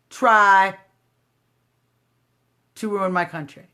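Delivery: ordinary delivery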